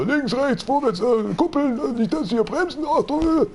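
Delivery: high, rushed barking of driving orders; hectic driving instructor. Retold or imitated. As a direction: fire off orders like a nervous instructor. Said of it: "das erinnert mich schon wieder so an einen Führerschein". (hektischer Fahrlehrer, hohe Stimme)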